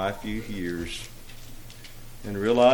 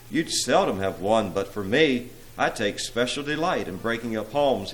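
usual speaking voice

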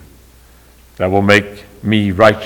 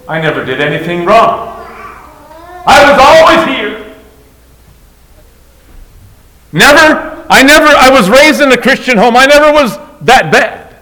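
loud, high indignant complaint; resentful older brother; 12 semitones higher, 8 dB louder than usual. Acted. Preacher voicing the older brother's grievance to the father: loud, high, indignant. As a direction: rant like the wronged older brother.

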